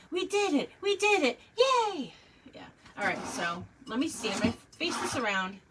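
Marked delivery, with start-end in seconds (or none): 0.1-2.1 high, loud cartoon cheer; Dora the Explorer. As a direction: cheer like a kids' show host. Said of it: "now it's Dora the Explorer".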